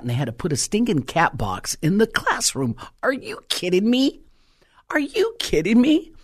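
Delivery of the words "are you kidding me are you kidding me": much higher incredulous cry, climbing; are you kidding me, rising disbelief. Real, not acted. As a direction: let the outrage burst up in pitch. Indignant disbelief.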